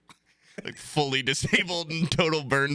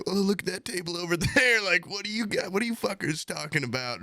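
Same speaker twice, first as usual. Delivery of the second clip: crowd-work comic; 8 semitones higher than usual. Retold or imitated. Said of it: "the guys just constantly were like".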